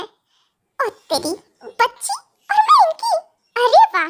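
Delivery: high, incredulous exclamation; astonished. Acted. Wife mistaken for his child, astonished. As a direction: start hushed, then leap up high in disbelief.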